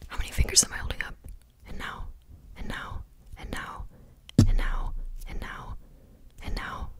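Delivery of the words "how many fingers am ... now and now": very fast whisper; fast aggressive asmr. Acted. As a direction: rattle it off in a rapid whisper. Rapid whispered question.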